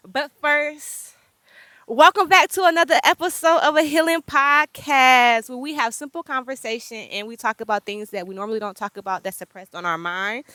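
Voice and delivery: loud announcer-style show intro; podcast intro. Acted. Host welcoming listeners back to the podcast, announcer style. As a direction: announce the show with full energy.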